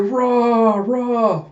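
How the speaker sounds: high, drawn-out cheering noise; imitated crowd roar. Narrator imitating the crowd at tunnel's end, high roar. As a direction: mimic a roaring arena crowd.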